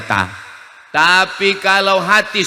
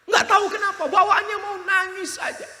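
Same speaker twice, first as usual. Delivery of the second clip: tearful singer. Retold or imitated. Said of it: "Saya nangis".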